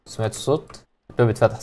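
quietly asking if he heard the door; half-whispered worry at a strange sound; hushed, uneasy. Real, not acted. Player hears the door open, asks in a hushed voice.

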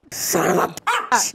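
slow, loud hammed-up curse; mock outburst. Friend shouting a joke curse for the bark sound effect. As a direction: bellow it like a cartoon swear. Joking exclamation after dog-bark gag.

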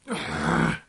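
voiceless huff of frustration; exasperated sigh. Real, not acted. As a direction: breathe out hard, words failing. Cannot finish, frustrated by graphics.

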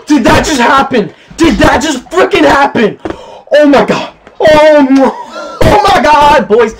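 high, loud amazed cries; can't believe what just happened; stunned disbelief. Real, not acted. Disbelief after goal.

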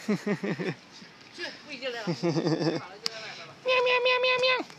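very high, soft imitation meows; meowing at cats. Real, not acted. A walker meows at the stray cats in a tiny high voice. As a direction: meow back at the cats in falsetto.

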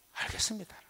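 breathy whispered yes, giving in; whispered surrender. Retold or imitated. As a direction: whisper your surrender to God. 하나님께 항복하듯 대답. (하나님께 속삭이듯 항복하는 대답)

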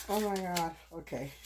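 ordinary delivery

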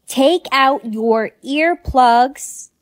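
word-by-word slow instruction; slow, deliberate. Acted. Talking to a friend in earplugs, slow and deliberate. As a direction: spell it out slowly for someone who can't hear.

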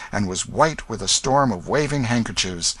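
ordinary delivery

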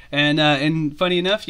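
normal talking voice

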